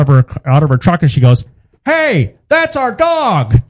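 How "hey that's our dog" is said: high, slow indignant woman's voice; neighbor lady voice. Retold or imitated. Son imitating the neighbor lady spotting her dog, high and indignant. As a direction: claim the dog back, outraged.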